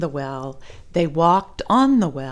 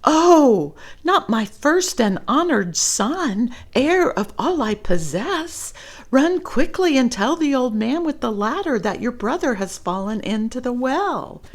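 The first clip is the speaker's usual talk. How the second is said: alarmed mother; 6 semitones higher, 3 dB louder than usual. Acted. Mother alarmed, sends Chang.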